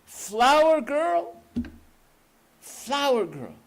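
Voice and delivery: very high, loud searching call, slow on the last. Acted. Nurse searches the smoke for the flower girl, high loud calls. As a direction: cry out into the smoke for a lost girl. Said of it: "she started calling".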